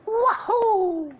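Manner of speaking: very high, loud cartoon whoop; Mario. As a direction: whoop it gleefully, high and drawn out. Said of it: "hears a faint".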